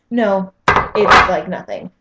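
slowed and weighted on "nothing"; slow emphatic. Real, not acted. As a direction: lean hard on "nothing" to set the viewer straight. Correcting the imagined viewer.

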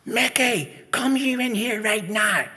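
high-pitched mother's yell; street-filling call. Acted. Ma calling her son indoors, yelling. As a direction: holler for your child down the street. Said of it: "My ma's voice fills the street".

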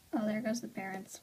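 usual speaking voice

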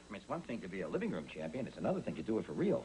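ordinary talk

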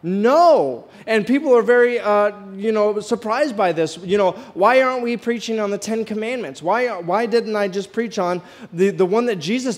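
ordinary talk

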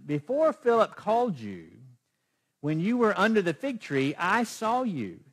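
proclaiming Jesus. Acted. Preacher voices Jesus answering Nathanael, loud and proclaiming. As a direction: declare it as Jesus, drawing out the last words.

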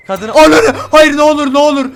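loud, high panicked begging; panicked pleading. Real, not acted. Gamer begs the attacking woman, panicked and loud. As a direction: beg in a rising panic. Panicked yelling at game enemy.

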